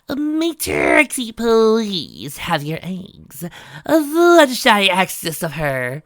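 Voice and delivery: slow, high, loud overly polite request; be polite through gritted teeth; forced politeness. A narrator's reading. Trixie politely asking the hen for eggs, slow and strained. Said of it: "as politely as possible".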